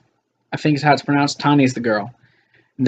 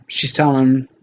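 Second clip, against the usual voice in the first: ordinary delivery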